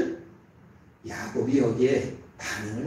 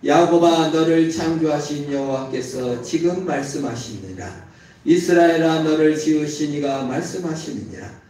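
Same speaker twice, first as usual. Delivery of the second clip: loud, solemn reading aloud with the congregation; loud unison Bible reading; scripture reading; 3 semitones higher, 8 dB louder than usual. Acted. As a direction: proclaim the verse together, full voice.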